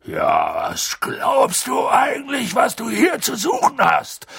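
outraged big-man roar, pitch leaping up; sudden roar. A narrator's reading. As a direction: erupt without warning into furious bellowing. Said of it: "brüllte er so plötzlich los".

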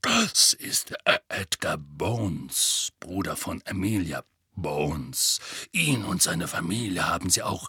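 name the dead low and grim. Acted. Moody showing Harry the old Order, low and grim. Moody continues naming comrades.

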